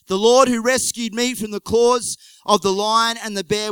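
loud, high, defiant boast; bold declaration. Acted. David telling King Saul he will win, loud and defiant. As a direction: proclaim it with full confidence.

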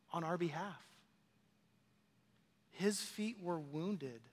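hushed, reverent scripture reflection; solemn preaching. Real, not acted. Preacher reflecting on Christ's pierced feet, softly solemn. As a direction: soften right down, with long weighty pauses.

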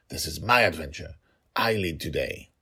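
ordinary delivery, nothing marked

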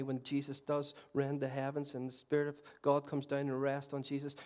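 normal talking voice

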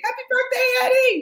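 very high, loud celebratory squeal; birthday shout-out. Real, not acted. Calling out birthday wish to friend.

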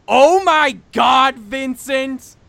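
high, loud, stretched shocked cry; shocked shriek. Retold or imitated. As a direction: shriek his name in horror. Mom shrieks at the backflip.

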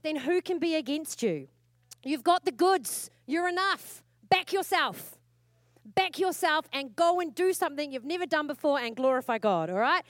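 loud, high preaching push; rally the church to back themselves. Acted. Preacher urging the congregation, loud and high.

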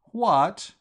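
loud, high, startled "What?"; stunned outburst; shocked cry. Acted. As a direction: yelp it out in sudden dismay. Gussie shocked at the news.

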